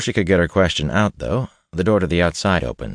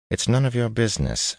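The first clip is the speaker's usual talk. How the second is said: curt; 7 semitones higher than usual. Acted. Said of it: "she said curtly".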